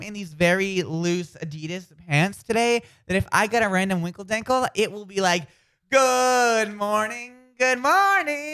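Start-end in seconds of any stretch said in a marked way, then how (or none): 5.9-8.5 mock sing-song greeting.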